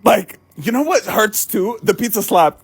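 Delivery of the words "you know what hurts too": ordinary talk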